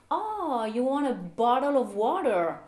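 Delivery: high, exclaiming American waitress; exaggerated American drawn-out 'Oh', T's as D's; American accent. Waitress finally understands the customer, high American accent. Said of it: "dont les Américains vont prononcer le T".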